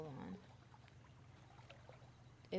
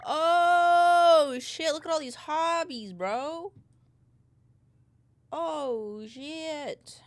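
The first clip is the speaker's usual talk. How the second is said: loud excited outburst, pitched up; 9 semitones higher, 14 dB louder, much slower than usual. Real, not acted. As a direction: shout it out in delight. Excited at hobby screen.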